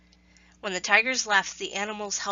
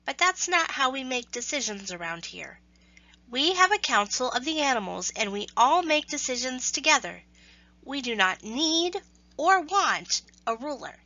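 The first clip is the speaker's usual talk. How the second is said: little mouse; 5 semitones higher than usual. Acted. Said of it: "a little mouse said".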